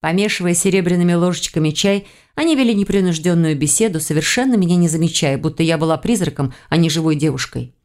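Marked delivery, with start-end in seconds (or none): none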